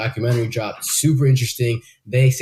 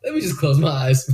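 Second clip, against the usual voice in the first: high-pitched mock surrender; giving in; 8 semitones higher than usual. Telling himself he can rest his eyes, mock giving in.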